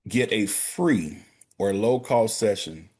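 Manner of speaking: slow, with "free" drawn out and leaned on; stress on free. Real, not acted. Banker urging listeners, slowly stressing "free". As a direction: stretch out "free" so it lands. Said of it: "I like to emphasize the word free here".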